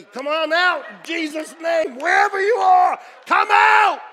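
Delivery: high, bellowed preacher's command; full-force exorcism shout. Retold or imitated. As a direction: shout it out like casting out a spirit. Mock deliverance shout, hands on himself.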